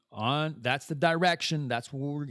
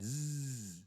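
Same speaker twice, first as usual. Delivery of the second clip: soft, long "zzz"; soft sustained buzzing; imitated buzz; 13 dB quieter than usual. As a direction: buzz quietly and hold it.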